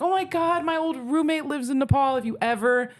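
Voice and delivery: high-pitched retold excitement; eager, high quoted voice; excited friend. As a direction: imitate a friend bubbling over with a sudden idea. Annika retold, excited by coincidence.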